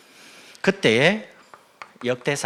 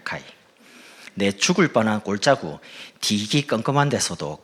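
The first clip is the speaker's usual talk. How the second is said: Gyeongsang dialect. Acted. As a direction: recite it in broad Gyeongsang dialect. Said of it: "경상도 버전으로 제가".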